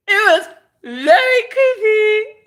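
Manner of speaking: loud, very high, drawn-out squeal. Real, not acted. Recounting the creepy woman, squealing high and loud. Repeats the remark with rising intensity.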